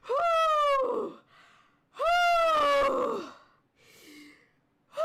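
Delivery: loud, high exhaled whoo; catching breath whoop. Real, not acted. Streamer needing to breathe after laughing, high recovering whoops. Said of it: "I gotta breathe".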